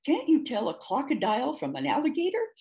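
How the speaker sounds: mildly offended retort; sputtering indignation. A narrator's reading. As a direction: put out at being mistaken. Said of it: "sputtered Ding".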